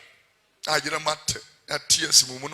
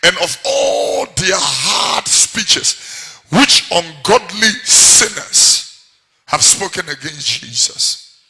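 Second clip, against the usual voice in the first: loud, driving sermon delivery; full-force preaching; 3 semitones higher, 10 dB louder than usual. Acted. Preacher hammering scripture at the congregation, loud.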